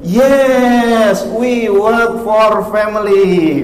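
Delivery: high, loud, slow trainer voice; foreign trainer. Retold or imitated. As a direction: imitate the American trainer slowly. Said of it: "Kata si bule". (meniru pelatih bule, tinggi dan lambat)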